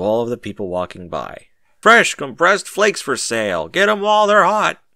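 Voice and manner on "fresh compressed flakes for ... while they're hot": loud, higher hawker's call; merchant's shout. A narrator's reading. Merchant shouting his wares to passersby. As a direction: bellow it like a market seller. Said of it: "he shouts".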